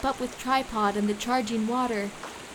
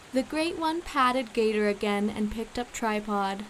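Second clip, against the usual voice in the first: ordinary delivery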